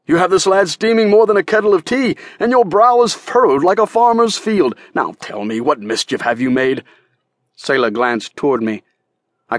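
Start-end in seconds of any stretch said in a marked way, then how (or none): 0.1-6.8 jovial merchant. A narrator's reading. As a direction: tease the fiery girl with good humour. Hancock teasing the girl.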